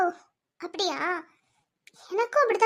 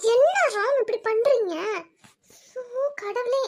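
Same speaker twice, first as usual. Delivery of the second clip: high, shaken lament; distraught; 5 semitones higher than usual. Acted. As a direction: cry out in disbelief. Distraught over Ram's jump. (மனமுடைந்த புலம்பல்)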